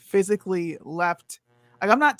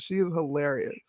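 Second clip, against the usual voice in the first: normal talking voice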